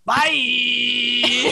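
laughing shouted pirate bye; pirate goodbye. Pretend. Ending the imaginary pirate episode, laughing shouted farewell. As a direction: sign off like a hammy pirate. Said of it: "That was the best pirate thing".

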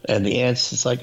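ordinary delivery

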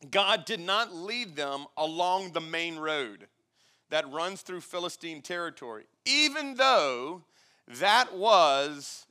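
slow, emphatic Bible reading; scripture reading. Acted. Preacher reads Exodus to the congregation, emphatic scripture reading. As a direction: read it out with weighty, drawn-out key words.

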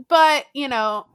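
ordinary talk

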